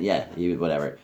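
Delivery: ordinary talk, nothing marked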